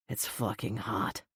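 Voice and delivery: low, soft murmur; husky low murmur. A narrator's reading. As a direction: murmur it close to her mouth. Bryce low, husky aside.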